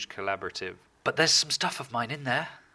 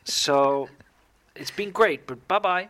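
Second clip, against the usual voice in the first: imagined firing; 7 semitones higher, 6 dB louder, slower than usual. Retold or imitated. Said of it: "waiting for someone to go".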